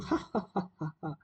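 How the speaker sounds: high staccato acted cackle; hammed-up prankster's ha-ha-ha; shepherd's mock laugh. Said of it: "He started laughing".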